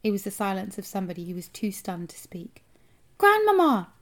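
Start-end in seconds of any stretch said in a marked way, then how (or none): 3.2-3.9 high, loud yell for attention; shouting. A narrator's reading. Boy to silent grandmother below, shouting. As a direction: holler down from up high. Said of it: "I shouted again".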